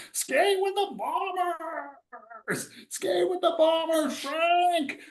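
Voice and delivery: put-on high-pitched, slow drunken cry; drunk yelling. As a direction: mimic a giddy drunk friend. Drunk friend quoted again.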